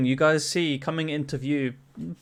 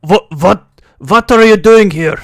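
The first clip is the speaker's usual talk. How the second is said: put-on shouting Russian accent; 5 semitones higher, 14 dB louder than usual. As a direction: drag out a booming accented challenge. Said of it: "shouts out".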